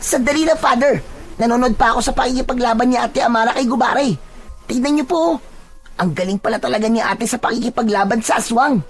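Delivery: put-on high, excited child voice; high-pitched boyish thrill, last word stretched; awestruck young boy. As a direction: gush like a kid watching a hero fight. Voices Boyet watching the fight.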